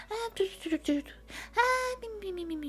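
high, soft cutesy snoozing noises; tiny sleeping snores. Retold or imitated. Streamer imagines little fireflies sleeping by the trees, high cutesy snores. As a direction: imitate tiny creatures snoring, squeaky and falling away. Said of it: "Tänk små eldflugor som sover".

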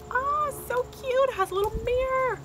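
very high, excited cooing; cooing delight. Real, not acted. Spotting the charm's little mirror, delighted cooing. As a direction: coo in a high, melting voice.

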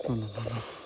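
very low, soft murmur; hushed. Real, not acted. Ghost hunter alone after hearing La Llorona, hushed. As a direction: murmur it low, almost inaudible. Frightened muttering at night.

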